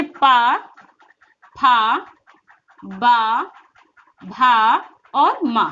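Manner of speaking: पा, फा, बा, भा slow and firm, vowels held; articulation demo. Shown as a demonstration. Teacher showing the lip sounds, पा, फा, बा, भा drawn out. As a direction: stretch पा, फा, बा, भा singly, then और मा. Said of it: "उच्चारण स्थान होता है होंठ से होता है".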